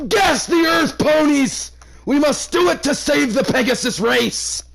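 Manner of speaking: high, loud ranting fanatic shout; hammed-up villain yelling a rallying cry; mock villain rant. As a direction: bellow it like a crazed dictator. Mock villain rant about ponies.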